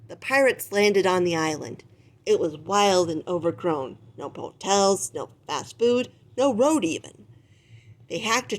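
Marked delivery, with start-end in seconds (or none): none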